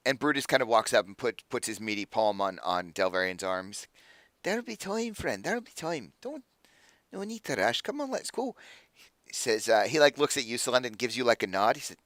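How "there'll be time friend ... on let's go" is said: soft, high-lilting reassurance; hushed, kindly dwarf voice, pitched up; gentle consoling. Acted. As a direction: quietly coax a disappointed friend along. Dwarf consoles his dejected friend.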